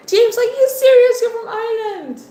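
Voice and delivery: high-pitched, astonished outburst; amazed, voice leaping up; real surprise. Real, not acted. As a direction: burst out loud and high in delighted disbelief. Surprised at viewer from Ireland.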